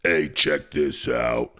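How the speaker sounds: lightly voiced low demon; a hint of a slow menacing demon. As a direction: drag it out slowly, low. Demon impression continued.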